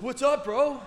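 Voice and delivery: very high, loud mock comeback; worked-up, high-pitched yell; raging retort. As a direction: imitate an angry driver throwing the greeting back. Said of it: "he's raging".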